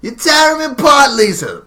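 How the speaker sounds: high, loud hammed-up cry; mock movie line. Friend quoting the film's famous line, loud and hammy. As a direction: belt it out like bad melodrama. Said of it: "the mom tells, Lisa".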